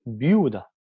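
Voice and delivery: Viuda said slowly and clearly, stress on the u; demo word. Shown as a demonstration. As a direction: sound out Viuda so the u carries the tone. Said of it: "tenemos que escuchar cómo suena".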